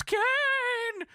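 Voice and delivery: very high, slow, drawn-out cry; stretched ghostly call from the distance; distant Paul Bearer yell. Retold or imitated. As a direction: wail it from far away. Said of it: "Paul Bearer yelling from the distance".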